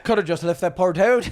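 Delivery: mock an Irish buddy's brogue; Irish accent. Comedian doing his Irish buddy's accent, exaggerated Irish. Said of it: "don't do his accent".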